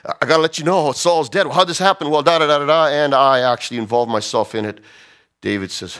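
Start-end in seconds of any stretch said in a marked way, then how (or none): 0.1-4.8 higher and louder, breathless news from the battlefield; urgent report. Retold or imitated. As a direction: blurt out the bad news urgently. Messenger reports Saul's death.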